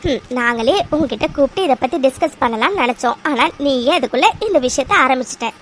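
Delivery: ordinary talk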